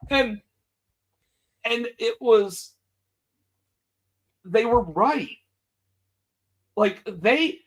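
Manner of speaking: ordinary talk, nothing marked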